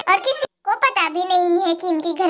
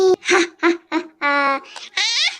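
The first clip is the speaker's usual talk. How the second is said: witch's cackle; 4 semitones lower than usual. Acted. Witch's laugh.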